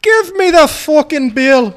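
put-on slow, high, loud British-accent yell; mock accent shout. Tough guy ending the scene with a loud, slow fake-accent yell. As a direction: bellow it out slowly in a bad British accent. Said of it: "The last shitty accent I can pull out".

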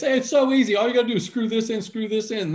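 high-pitched, quick-talking send-up of simple directions; mock breezy instructions. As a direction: act out someone making assembly sound effortless. Mocking easy assembly instructions.

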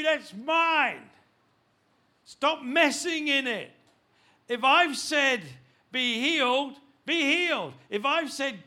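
loud, high, commanding voice of God; booming voice of God. Acted. Preacher voicing God to the congregation, loud commanding. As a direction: thunder it out as God laying down the law. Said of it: "and God's saying".

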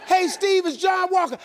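high, loud cheerful caller; hearty shouted morning greeting; John Walker. Retold or imitated. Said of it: "he called me the exact same way".

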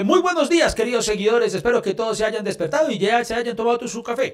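posting a voice greeting. Acted. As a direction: put on a chipper morning post for followers. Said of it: "usted podía decir".

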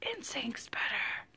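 a sly whispered confession, half to herself; whispered aside. Real, not acted. Admitting her boy band favourite to her co-host, whispered. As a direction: drop to a secret whisper for the guilty opinion.

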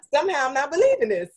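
fast, amused doubt breaking into a laugh; laughing disbelief. Real, not acted. Cohost laughing at the host's claim, real laughter. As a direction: laugh him off, not buying it.

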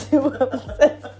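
words squeezed out through real laughter; laughing. Real, not acted. Podcaster cracking up over her obsessive friend, helpless laughing. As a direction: crack up while repeating yourself. Cracks up at own retold line.

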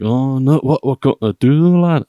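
put-on a young northern English couple's worried outburst in a northern English accent. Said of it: "they're all northern and whatever".

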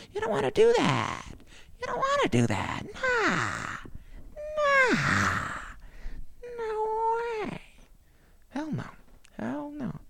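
high mock voice ridiculing hippies; high-pitched mock voice. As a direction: push it up into a squeal. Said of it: "Tim Dillon with a high pitch".

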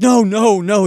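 high, loud, hammed-up protest; hasty mock denial. Mombi answers the angry Gnome King, mock panicked denial.

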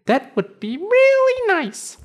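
very high tiny cricket voice. Acted. Cricket thanks them.

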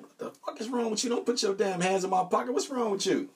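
high, outraged retold yell; retold outburst. To the stripper with her hand in his pocket, high outraged retold yell. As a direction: replay the moment he lost it, pitched high. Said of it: "I lose my mind".

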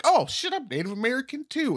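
high eager exclaiming; mock excitement. Acquaintance eagerly claiming native roots, mock excitement. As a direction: overeager to claim kinship. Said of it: "they're gonna be like".

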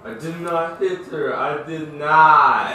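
lightly voiced, laughing movie-director impression; half-laughing send-up of the film's famous line; a hint of the cult-film director; The Room director impression. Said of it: "laughed like the, the, like the dude that created the, that terrible movie called The Room".

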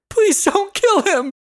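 high, loud pleading cry; desperate begging. A narrator's reading. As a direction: beg for his life. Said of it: "Pearl begged".

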